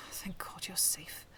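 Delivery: slow, whispered relief; muttered. A narrator's reading. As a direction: breathe it out slowly, relieved. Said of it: "he muttered".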